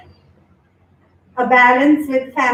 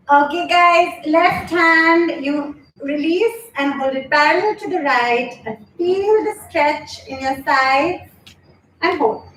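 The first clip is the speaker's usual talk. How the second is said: yoga instructor cueing; 3 semitones higher, slower than usual. Acted. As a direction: guide the pose, then hush the praise. Leads yoga poses.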